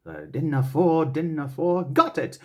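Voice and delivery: mock searching for the rhyme, then "got it"; puzzle, then land it; thinking it over. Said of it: "the part where it goes like".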